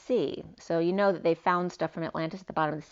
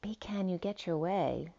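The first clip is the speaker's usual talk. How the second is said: hushed whisper, much softer; 6 dB quieter than usual. Real, not acted. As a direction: whisper it as if reading to yourself. Whispers written line to self.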